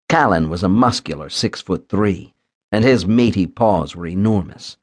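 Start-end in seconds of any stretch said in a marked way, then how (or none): none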